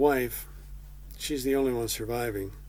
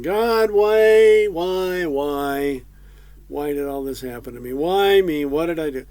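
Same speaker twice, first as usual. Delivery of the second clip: loud, higher self-pitying wail; whining; 3 semitones higher, 10 dB louder than usual. Retold or imitated. Job complaining to God, loud whining self-pity. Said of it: "He's really whining".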